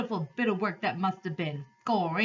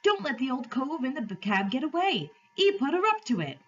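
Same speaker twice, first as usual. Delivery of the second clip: high-pitched call from the crowd; 7 semitones higher than usual. A narrator's reading. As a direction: raise the alarm from among the onlookers. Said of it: "somebody else called out".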